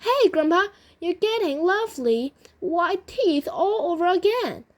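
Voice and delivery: high, delighted call to grandpa; acted boyish amazement; excited marvelling. A narrator's reading. Boy marvels at grandpa's change.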